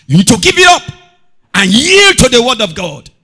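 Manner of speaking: high, loud preaching climax; preaching shout. Real, not acted. Preacher urging the church to yield, shouting. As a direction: hammer it home at full force. Preacher driving the point home.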